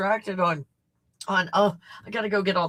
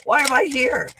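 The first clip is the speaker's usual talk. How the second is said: higher, louder confused newcomer; mock bewildered Lynn; 5 semitones higher, 7 dB louder than usual. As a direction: imitate someone loudly baffled at being here. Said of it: "Lynn's like".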